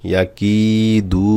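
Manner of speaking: "কি" and "দু" held long; one-alif stretch. Shown as a demonstration. Teacher building the word for learners, long "কি" and "দু". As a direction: draw out the i of "কি" and the u of "দু". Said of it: "এক আলিফ করে টান হবে".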